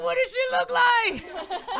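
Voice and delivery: high, loud, stretched-out question; mock freaked-out child. Son asking mother about tooth fairy, mock alarm. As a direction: imitate a kid's alarmed questioning. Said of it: "I freaked him out".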